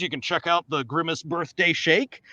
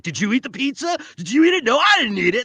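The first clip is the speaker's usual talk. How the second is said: high, louder playful squabble; mock accusing argument; 9 semitones higher, 6 dB louder than usual. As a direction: act out two people bickering over a theft. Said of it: "you would be questioning each other".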